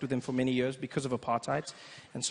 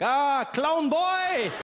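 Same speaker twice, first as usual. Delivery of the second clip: put-on high, slow, loud German-accented greeting; German dad greeting; 10 semitones higher, 5 dB louder, much slower than usual. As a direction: boom it across the gate.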